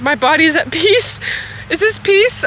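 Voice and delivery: breathless after walking; panting, out of breath. Real, not acted. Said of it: "I'm out of breath, tearing up".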